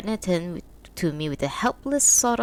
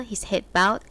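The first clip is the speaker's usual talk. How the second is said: ordinary delivery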